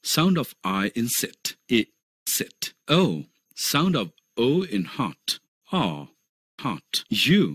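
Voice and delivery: each vowel sound said very slowly and clearly; slow phonics drill. Shown as a demonstration. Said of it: "vowels की ध्वनियों और उनके चिन्हों को देखते हैं". (धीमा, साफ़ उच्चारण अभ्यास)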